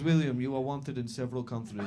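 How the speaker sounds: mock-official Eastern European cop; Eastern European accent. Cop accuses Will, Eastern European accent. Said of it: "this is my Eastern European accent".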